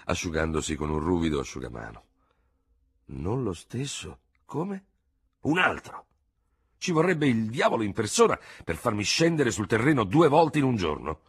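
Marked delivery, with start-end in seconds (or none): 3.1-4.8 slow, soft, then rising question; doctor puzzled by the news; puzzled surgeon. Acted. 5.4-11.2 higher, louder grim voice; officer grimly explaining a second duel; bitter lieutenant. Acted.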